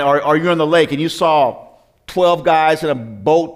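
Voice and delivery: normal talking voice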